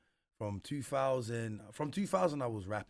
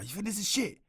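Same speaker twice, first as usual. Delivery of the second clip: indignant; 12 semitones higher, 7 dB louder than usual. Retold or imitated. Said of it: "I'm like".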